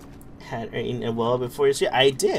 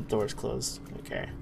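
usual speaking voice

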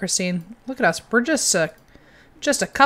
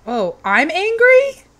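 high, loud and drawn out in disbelief; surprised; 10 semitones higher, 9 dB louder, slower than usual. Real, not acted. Player startled that her Sim is angry, loud surprise. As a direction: stretch it out, genuinely baffled.

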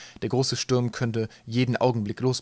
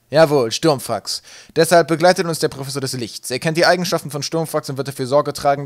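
loud, raised confident address; commanding announcement; 4 semitones higher, 9 dB louder than usual. A narrator's reading. Captain explaining the professor's role to crew, loudly commanding.

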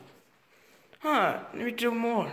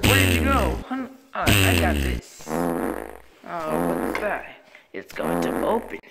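deep character voice. Acted. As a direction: drop into a deep gruff character. Deep put-on voice.

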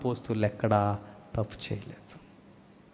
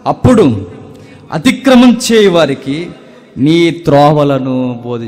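high, loud pulpit recital; impassioned prayer, loud and ringing; preaching at full force; 5 semitones higher, 11 dB louder than usual. Acted. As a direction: cry the verses out to the congregation. Preaching scripture loudly.

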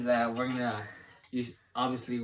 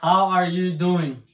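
loud, slow and higher, over headphones; loud uncertain guess; 5 semitones higher, 8 dB louder, slower than usual. Real, not acted. Guessing partner's words through loud music, loud and slow. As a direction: call out your guess word by word.

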